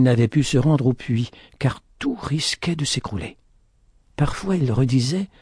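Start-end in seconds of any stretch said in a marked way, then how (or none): none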